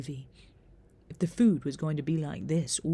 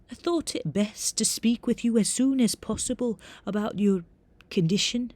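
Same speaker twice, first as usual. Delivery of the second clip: higher-pitched Scottish teacher broaching a delicate matter; reedy, formal Scottish professor; reedy Scottish accent; 5 semitones higher, 5 dB louder than usual. A narrator's reading. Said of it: "in a reedy Scottish accent".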